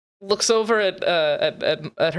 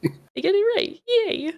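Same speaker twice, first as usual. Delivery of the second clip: excited; 12 semitones higher, slower than usual. Real, not acted. Cheering over a right answer.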